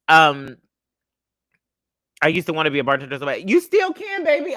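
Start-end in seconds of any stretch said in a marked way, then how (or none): 3.5-4.5 sudden high, bright reply to a viewer; high reassurance. Real, not acted. Host telling a viewer they still can, in a high voice. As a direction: pop up high and sweet to encourage.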